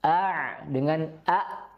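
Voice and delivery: "A" throaty ain then clean hamzah, slow; slow A-A contrast; ain versus hamzah. Shown as a demonstration. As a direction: contrast the two A sounds slowly. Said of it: "tentu itu berbeda".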